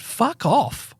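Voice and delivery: high, exasperated dismissal; retold losing it. Dog walker ending a rant at the neighbour, retold exasperation. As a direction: send them away in a mock outburst. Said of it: "and I just lost it".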